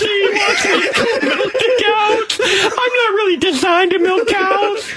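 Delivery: high, mock-tearful city boy; Tyler. City boy complaining about milking cows, high mock-crying voice. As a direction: whimper it high, hammed-up self-pity. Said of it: "this is exactly how he talks".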